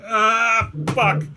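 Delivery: high, drawn-out acted "ah", then a curse; mock falling cry. Game master as the falling dwarf, mock cry. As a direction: cry out like you're losing your grip.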